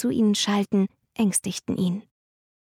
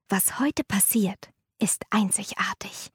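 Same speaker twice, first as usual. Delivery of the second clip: hushed mysterious whisper; 4 dB quieter than usual. Acted. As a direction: whisper it like a secret. Mother lowers voice mysteriously.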